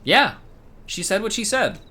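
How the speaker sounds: high, loud, sassy insistence; emphatic defense. Real, not acted. Streamer backs up a cheeky chat comment, sassy and loud. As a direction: back the viewer up with sass.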